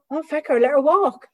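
high, loud Irish dismissal; Irish grumble. Retold or imitated. The Irish driver giving up on her, a high, loud Irish grumble. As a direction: shrug her off in an Irish accent. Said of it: "And I thought".

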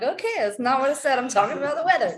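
high, loud, amused correction; exasperated retelling. Retold or imitated. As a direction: correct mom loudly, half laughing. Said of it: "And I'm like".

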